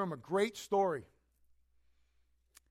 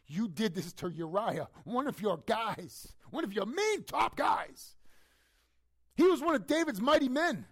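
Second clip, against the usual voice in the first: slow, then higher and louder to a shout; prophet's rising accusation; 8 semitones higher, 6 dB louder than usual. Acted. Prophet Nathan confronting King David, crescendo to a shout. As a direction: build the charge until it bursts out. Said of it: "He goes".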